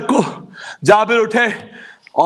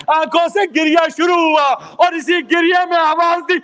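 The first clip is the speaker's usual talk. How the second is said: rising, shouted mourning oratory; impassioned majlis preaching; 12 semitones higher, 5 dB louder than usual. Acted. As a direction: preach the grief out at full force. Majlis preaching of Jabir at Karbala. (پرجوش مجلس خطابت، بلند آواز میں)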